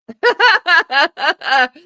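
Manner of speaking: high burst of laughter; real nervous laugh; loud nervous laugh. Real, not acted. Awkward pause on live.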